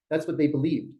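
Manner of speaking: ordinary talk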